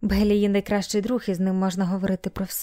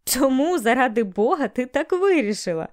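raised, emphatic disbelief; incredulous question; 4 semitones higher than usual. A narrator's reading. Bailey questioning Melanie's confession, incredulous. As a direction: ask in mild, amused bafflement. Bailey baffled after laughing hard.

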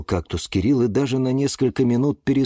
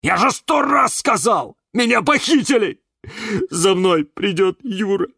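high, loud outraged protest; indignant roar; 11 semitones higher, 5 dB louder than usual. A narrator's reading. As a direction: protest loudly, voice pitched way up. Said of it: "рявкнул он". (возмущённый крик)

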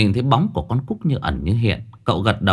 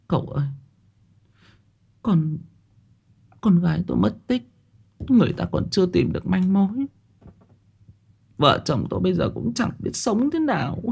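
higher, softer voice on the verge of tears; tearful pleading; 9 semitones higher, 4 dB quieter than usual. Acted. Grieving mother telling Cậu her daughter is missing, tearful. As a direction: plead through a quivering mouth. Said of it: "Bà Thu mếu máo".

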